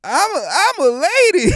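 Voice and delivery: Southern cartoon lady, rising high and loud; Sandy Cheeks. Cartoon character hinting who she is, loud Southern lady. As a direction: push up into a big Southern-belle cartoon. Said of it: "it's Sandy Damn Cheeks".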